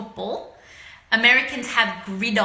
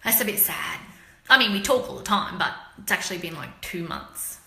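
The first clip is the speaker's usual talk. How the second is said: ordinary talk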